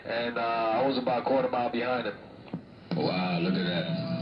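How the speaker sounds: ordinary talk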